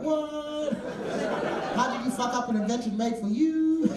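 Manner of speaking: incredulous squeal at the driver; mock disbelief. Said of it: "I was like".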